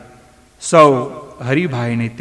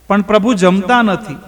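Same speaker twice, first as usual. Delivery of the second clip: higher, mildly tearful પણ પ્રભુ જમતા નથી; a hint of tears on પણ પ્રભુ જમતા નથી, raised pitch; lightly weepy, higher પણ પ્રભુ જમતા નથી; crying voice; 6 semitones higher than usual. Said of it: "ધનાએ રડતા અવાજે કહ્યું".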